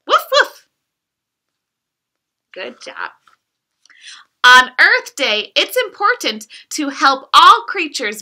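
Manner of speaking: lively, sing-song picture-book reading; reading to children. Acted. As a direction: read it like bedtime storytime for a toddler. Reads picture book aloud to child.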